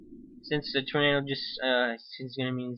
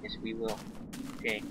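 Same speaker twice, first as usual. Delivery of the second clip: quiet pleading; 3 semitones lower, 7 dB quieter than usual. Real, not acted. Pleading with tornado softly.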